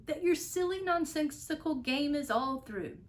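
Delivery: high, scolding snap; snapping. Acted. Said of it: "snapped the big kangaroo".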